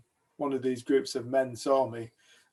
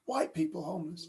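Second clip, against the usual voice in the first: softer, higher echo of disbelief; disbelieving; 4 semitones higher, 5 dB quieter than usual. Retold or imitated. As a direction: quietly query it as if impossible. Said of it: "He couldn't believe it".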